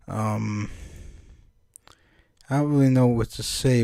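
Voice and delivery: ordinary delivery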